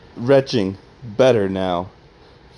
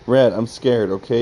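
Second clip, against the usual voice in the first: usual speaking voice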